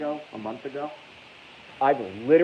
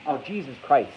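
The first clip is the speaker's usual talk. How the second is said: ordinary delivery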